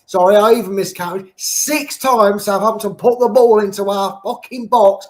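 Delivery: ordinary delivery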